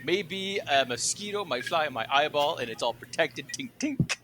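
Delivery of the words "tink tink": high, light imitated tink; little high-pitched pinging noises; tink tink sound. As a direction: imitate something tiny bouncing off glass. Imitates bug bouncing off glasses.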